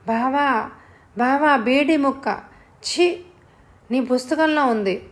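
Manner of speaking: high-pitched alarm, held short of a scream; startled cry. A narrator's reading. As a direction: cry out at a nasty find. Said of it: "కెవ్వుమంటూ అరవబోయింది". (కెవ్వుమనబోయినట్టు కంగారుగా)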